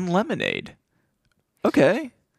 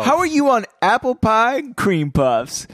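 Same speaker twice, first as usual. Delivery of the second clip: higher, drawn-out playful come-on; smooth line; 6 semitones higher, slower than usual. Acted. Cohost replays Joe's dessert line, slow and smooth. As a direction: stretch it out like a smooth pickup line. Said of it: "that's a smooth line".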